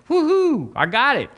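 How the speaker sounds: high cheering whoop of delight; the healed lady cheering to the minister, high and delighted; joyful whoop. Retold or imitated. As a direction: whoop like you've just been healed.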